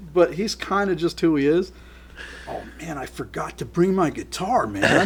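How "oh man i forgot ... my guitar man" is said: imitated laid-back comic act; Tommy Chong. Comedian admitting he forgot his guitar, laid-back imitation. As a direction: play the forgetful stoner, dropping off at the end. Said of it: "it's an act a little bit".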